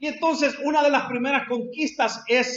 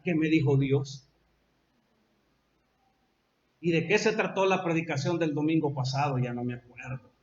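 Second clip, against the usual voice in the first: forgetful murmur; 9 semitones lower, 6 dB quieter than usual. Acted. Forgetful churchgoer voiced.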